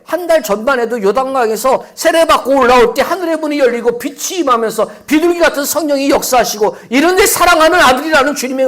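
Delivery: loud, high jeering taunt; mock the weary one at full volume; sneering tempter. Tempter jeering at Jesus, loud and high. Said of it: "이 비아냥거리는 음성이란 말이에요".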